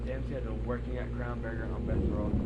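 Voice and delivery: normal talking voice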